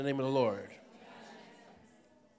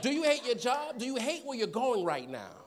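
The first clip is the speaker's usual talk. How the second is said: high, loud provocative question; train preacher's hook; 6 semitones higher, 5 dB louder than usual. Shown as a demonstration. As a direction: call it out to the whole train car. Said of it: "That's exactly how I would start".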